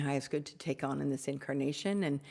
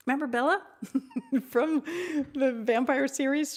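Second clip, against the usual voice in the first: much higher, giggling through it; laughing excitedly; 9 semitones higher, 5 dB louder than usual. Real, not acted. Recalling the vampire heroine for viewers, laughing. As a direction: laugh while recalling Bella. Laughing about Bella reference.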